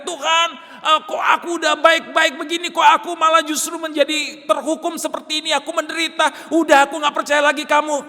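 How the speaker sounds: high, loud, despairing shout; screaming. Pretend. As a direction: scream out a bitter complaint to God. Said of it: "Yesus juga nggak teriak-teriak".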